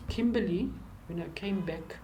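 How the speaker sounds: ordinary delivery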